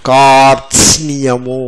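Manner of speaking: కార్త్స్నియము drawn out, r-t-s-n-y all sounded; long kaar, then a crisp t-s-ni-ya in కార్త్స్నియము; all five consonants. Shown as a demonstration. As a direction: slowly articulate every consonant in కార్త్స్నియము. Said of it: "నేను పలుకుతాను, ఐదు వచ్చాయో లేదో చూడండి".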